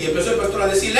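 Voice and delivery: normal talking voice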